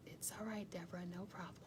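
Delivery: hushed and gentle; soft reassurance. Real, not acted. Reassuring Deborah who came late, softly. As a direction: ease her worry quietly.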